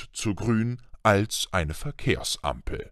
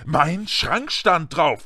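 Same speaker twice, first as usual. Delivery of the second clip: slow, higher indignant protest; angry; 6 semitones higher, slower than usual. A narrator's reading. Boy contradicting uncle about the letter, angry raised protest. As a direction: call it out word by word, angrily. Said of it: "rief Harry zornig".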